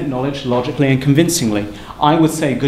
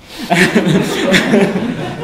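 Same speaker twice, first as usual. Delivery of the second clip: laughing; 6 semitones higher than usual. Real, not acted. Lecturer laughs recalling his hilarious professor, real laugh. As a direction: burst of real laughter, higher.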